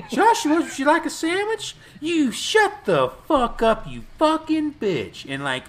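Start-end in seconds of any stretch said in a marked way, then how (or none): 0.1-1.8 high-pitched imitation of a mother offering; put-on high mom voice; mock doting mom. Mom offering son a sandwich. 2.0-5.2 high, slow, drawn-out mock fury; hammed-up furious kid; mock abusive outburst. Mock reply of son abusing mom.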